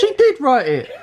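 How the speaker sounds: high, laughing insistence that she did; laughing protest. Real, not acted. Teasing wife who is crying.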